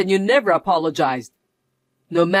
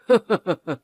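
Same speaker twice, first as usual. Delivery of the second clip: a short mocking laugh at her; gloating little laugh; smug chuckle. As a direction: chuckle down at her, pleased with yourself. Smug husband taunting trapped wife.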